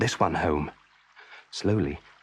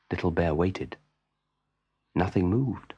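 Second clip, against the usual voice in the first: plain reading voice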